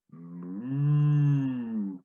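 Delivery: a drawn-out mock cow moo. Telling a joke, the cow says a lowing moo.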